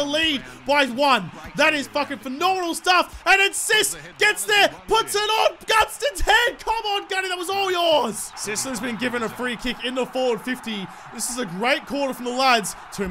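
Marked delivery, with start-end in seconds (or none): 3.3-8.3 hammed-up sports commentary; mock commentator call. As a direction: announce the goal like a play-by-play caller. Mock broadcast play-by-play.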